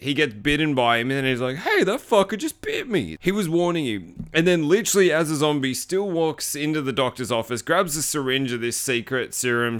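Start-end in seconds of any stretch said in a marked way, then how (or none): 1.6-4.1 mock whiny. Hunter whining after bite.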